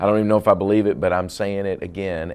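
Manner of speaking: loud and high, shouting it out. Retold or imitated. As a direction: shout it to God. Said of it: "screaming it".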